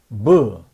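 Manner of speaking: "뭐" as an isolated B sound, buh. Shown as a demonstration. Teacher shows what B sounds like, isolated buh for "뭐". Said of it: "B는 뭐 소리가 나겠죠".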